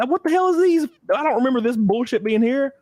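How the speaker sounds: high-pitched incredulous questioning; amazed outburst. Real, not acted. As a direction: blurt it in disbelief, voice way up.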